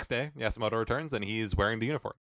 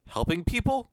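high rising "Helping people?", bemused and loud; a confused doctor puzzling over his own good deed; puzzled question; 13 semitones higher, 4 dB louder, slower than usual. Retold or imitated. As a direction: ask "Helping people?" like he can't believe it. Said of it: "Question mark".

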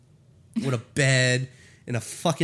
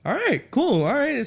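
mock acceptance; 10 semitones higher, 3 dB louder than usual. Acts out his reaction while reading.

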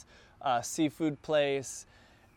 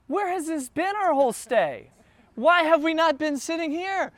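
amazed; 11 semitones higher, 5 dB louder than usual. Retold or imitated. Amazed at the hidden room.

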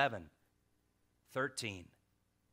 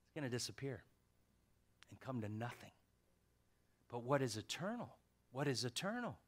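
soft reflective preaching; hushed preaching; 8 dB quieter than usual. Real, not acted. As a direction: pull them in close and quiet. Drops to hushed sermon voice.

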